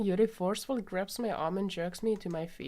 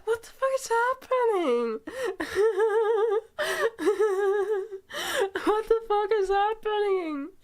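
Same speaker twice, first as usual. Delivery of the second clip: loud, very high squeal through laughter; hysterical laughing disbelief; 7 dB louder than usual. Real, not acted. Player baffled by the scene, in hysterics. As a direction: shriek it while cracking up.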